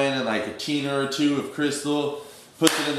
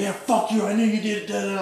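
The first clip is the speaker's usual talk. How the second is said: imitating a furious accusation, much higher; hammed-up angry dealer; mock angry; 10 semitones higher than usual. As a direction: throw the accusation back hard.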